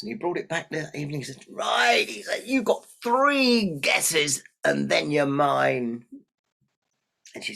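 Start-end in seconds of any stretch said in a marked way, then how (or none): 1.5-2.4 high, loud announcement; imp's challenge. Acted. Imp sets his terms. 2.4-6.0 slow, loud, drawn-out threat; villain's slow, loud gloating; menacing imp. Acted.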